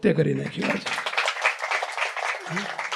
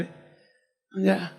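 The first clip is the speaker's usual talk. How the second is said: ordinary talk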